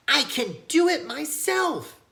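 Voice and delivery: put-on high, slow, insistent child voice; a stubborn kid grabbing the mitten; little boy demanding to do it alone; eager little boy. A narrator's reading.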